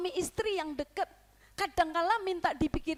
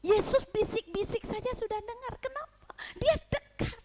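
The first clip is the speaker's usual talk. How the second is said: emphatic preaching; 8 semitones higher than usual. Real, not acted. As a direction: drive the point home. Rising sermon emphasis. (khotbah berapi-api)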